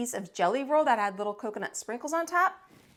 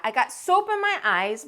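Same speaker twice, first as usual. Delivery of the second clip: ordinary delivery, nothing marked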